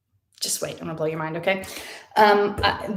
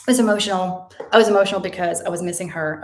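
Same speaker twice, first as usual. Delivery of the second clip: ordinary delivery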